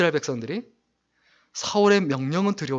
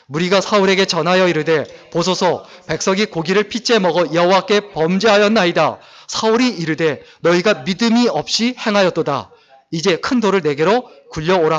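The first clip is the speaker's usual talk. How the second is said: loud, measured reading of scripture together; unison scripture reading; 2 semitones higher, 7 dB louder than usual. Acted. Pastor leads church in reading the verse, loud and steady. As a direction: read the verse aloud with the congregation. Congregation reads verse aloud.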